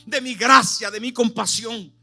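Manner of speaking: high, loud impassioned sermon voice; preaching at full force. Acted. Preacher calling the congregation to repent, full force. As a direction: preach it with everything you have.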